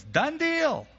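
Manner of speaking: very high, comic exclamation; hammed-up delighted acceptance; eager agreement. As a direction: jump at the bargain in a put-on high voice. Laban eagerly accepts the offer.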